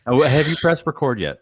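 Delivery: ordinary talk, nothing marked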